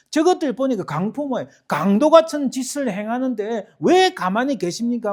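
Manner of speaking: high, loud indignant appeal to God; crying out. Acted. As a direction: demand an answer at full voice. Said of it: "하고 부르짖는 거예요".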